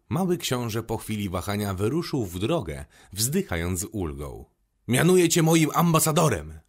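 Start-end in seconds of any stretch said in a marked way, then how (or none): 4.9-6.6 loud, high royal proclamation; raised voice, grandly appointing; king's exclamation. A narrator's reading. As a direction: call it out after someone leaving, pitched up. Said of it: "wykrzyknął jeszcze król".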